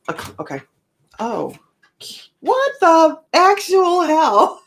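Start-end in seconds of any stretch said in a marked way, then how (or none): 2.4-4.6 high, loud, slow disbelief; shocked outburst. Real, not acted. Reading a shocking card spread, loud and stunned. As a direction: stretch it out in real shock.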